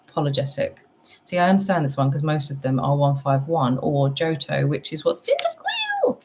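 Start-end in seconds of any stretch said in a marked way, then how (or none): 5.2-6.2 very high squeaky creature cry of the name; Cyndaquil noise. Retold or imitated. Vlogger to chat, imitating Cyndaquil's squeaky cry. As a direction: squeak the name like the little fire Pokémon. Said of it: "That's the noise he makes".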